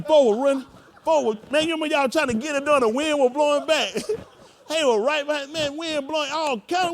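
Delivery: loud, high storm-story preaching; fiery preaching. Acted. Preaching at full force.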